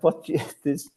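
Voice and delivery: high, loud mock protest; playing up being put on the spot; exasperated retort. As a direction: protest helplessly, pitch pushed up. Acted exasperated retort to the bench.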